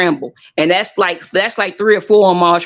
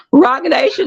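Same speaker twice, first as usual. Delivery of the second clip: excited gasp; 9 semitones higher than usual. Real, not acted. Excited at a new catchphrase.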